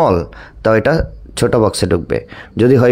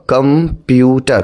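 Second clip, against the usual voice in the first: Computer split slowly into কম, পিউ, টার; slow, segmented computer with a gap after each syllable; much slower than usual. Shown as a demonstration. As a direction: break computer into three separate beats. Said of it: "এক ঝুঁকে যতটুকু উচ্চারণ করা যায়".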